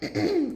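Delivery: a short, deliberate throat clear. Shown as a demonstration. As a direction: clear the throat to show the habit. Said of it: "trying to clear it".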